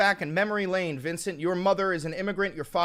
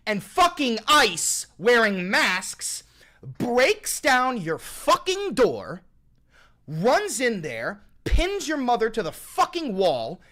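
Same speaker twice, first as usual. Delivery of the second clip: louder, slow, hammering each fucking blow; angry rant; 4 semitones higher, 3 dB louder, slower than usual. Real, not acted. Streamer to chatter Vincent, describing the raid, slow furious yelling. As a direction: rage building as the raid unfolds.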